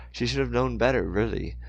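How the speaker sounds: ordinary delivery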